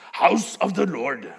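loud, slow put-on accent for a German phrase; mock foreign accent. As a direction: boom it out in a hammy, unplaceable accent. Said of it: "I don't know what accent that was".

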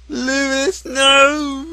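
high, loud, drawn-out mock anguish; anguished cry. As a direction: wail in hammed-up grief. Anguished cry for dying friend.

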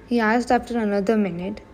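ordinary delivery

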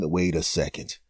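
low, soft aside; quiet musing. Acted. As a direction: murmur it to yourself. Quiet then loud repeat.

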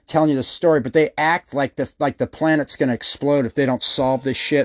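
ordinary delivery

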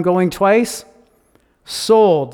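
loud closing call; auctioneer calling. Acted. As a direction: bang out the final call.

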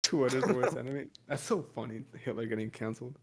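ordinary delivery

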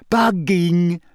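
bright-spirited reveal; happily. A narrator's reading. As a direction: announce it with happy emphasis. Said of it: "said Hermione happily".

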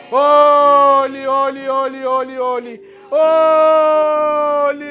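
ordinary delivery

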